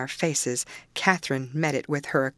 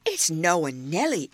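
put-on old servant in broad dialect; aggrieved rural Yorkshire retort; Joseph. A narrator's reading. Old servant correcting his master about the culprit, aggrieved.

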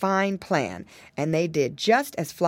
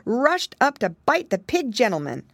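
plain reading voice

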